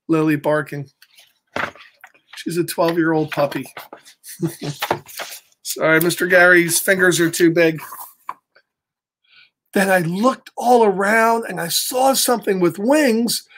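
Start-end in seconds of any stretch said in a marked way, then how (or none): none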